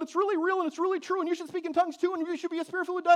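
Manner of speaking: high-pitched, hurried mimicry of a believer arguing; overeager, high-voiced defence; mock defensive insistence. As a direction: gabble it high, as if over-defending.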